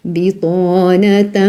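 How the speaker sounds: "Bitanatan" stretched slowly, syllable by syllable; slow tajweed demo. Shown as a demonstration. Teacher modelling the word for the student, slow. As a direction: say "Bitanatan" slowly, holding the nasal.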